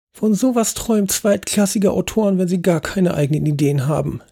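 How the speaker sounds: put-on high, disdainful old lady; Lady Agatha complaining. Lady Agatha scoffing at the host's plan, high and disdainful. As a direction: sniff at cheap authors from up high. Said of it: "beschwerte sich Lady Agatha".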